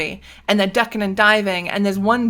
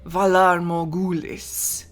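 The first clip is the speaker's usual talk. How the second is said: slow, lightly voiced rumbling assassin; a hint of a menacing killer, drawn out; slow, understated sinister rumble; deep rumbling voice; slower than usual. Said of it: "a deep rumbling voice that says".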